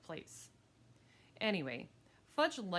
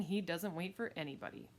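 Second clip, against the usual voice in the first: usual speaking voice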